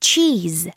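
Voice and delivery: "Cheese" with an English ch and long ee; "Cheese" crisp and clipped at the end. Shown as a demonstration. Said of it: "발음이 약간 달라요".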